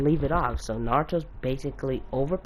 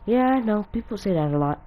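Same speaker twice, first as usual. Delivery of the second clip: high, louder opening that settles down; mock cocky reply; 10 semitones higher, 3 dB louder than usual. Naruto answering that he's powerful for his age, cocky. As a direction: breezily accept the compliment, pitched up at first.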